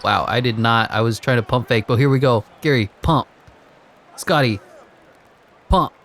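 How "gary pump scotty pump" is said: excited play-by-play. Real, not acted. Urging on a player in game.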